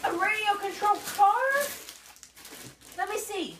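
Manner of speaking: high, loud delighted questions; excited. Real, not acted. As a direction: marvel at the new toy. Parent reacts to radio car.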